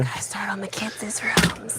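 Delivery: mocking whisper under breath; whispered echo. Real, not acted. Echoing partner's order mockingly.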